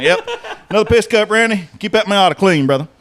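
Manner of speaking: chirpy wave-and-shout over the fence, laughing through it; cheery mock greeting. Telling the neighbor to keep his car clean, with a high cheery laughing call. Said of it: "I'm like".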